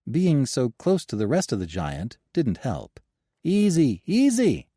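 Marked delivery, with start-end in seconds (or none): 3.4-4.7 loud, rising shout of easy. A narrator's reading. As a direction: call out to stop him. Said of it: "Gabriel shouted to get his attention".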